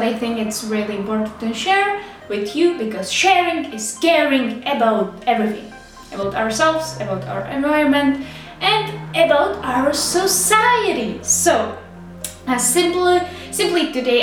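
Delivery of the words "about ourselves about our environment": ordinary talk, nothing marked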